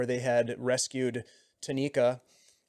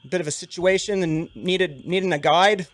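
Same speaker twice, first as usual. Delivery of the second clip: higher, louder Siluese guide voice; Siluese accent; 4 semitones higher, 5 dB louder than usual. Acted. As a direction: chat easily as the Siluese guide. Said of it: "Siluese accent".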